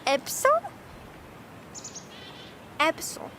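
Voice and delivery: Epistle shortened to EP-sul; trying out epistle. Shown as a demonstration. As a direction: sound out Epistle with the middle vowel dropped. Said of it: "do you say it more like".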